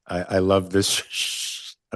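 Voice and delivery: trying to talk through laughter; real laughing. Real, not acted. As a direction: let a laugh break up the words. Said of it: "I'm sorry, I'm laughing".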